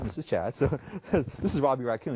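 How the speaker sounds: usual speaking voice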